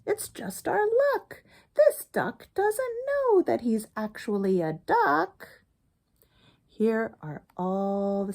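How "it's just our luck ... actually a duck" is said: high, fussing goose voice; lightly voiced fussing goose; flustered goose. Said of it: "flustered, and she fussed".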